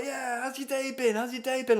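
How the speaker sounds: very high, over-keen imitation; enthusiastic boss. Big boss asking about his day, mock enthusiasm. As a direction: gush like an eager boss. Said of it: "he was all enthusiastic".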